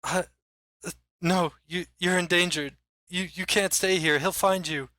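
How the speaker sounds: high, stammering urgency; fearful warning. Acted. Rattled mayor telling strangers they're in danger, fearful stammer. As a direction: warn them off, tripping over the words. Frightened mayor warns the party.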